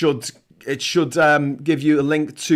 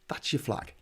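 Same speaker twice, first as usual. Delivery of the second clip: breathy whisper, much softer; whispered aside; 5 semitones lower, 14 dB quieter than usual. Acted. Whispering the new flag idea to New Zealand, hushed. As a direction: say it under your breath like a secret.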